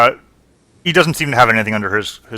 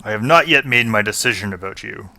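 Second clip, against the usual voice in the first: a wary dwarf withholding judgment; gruff dwarf. Acted. GM voices the dwarf NPC.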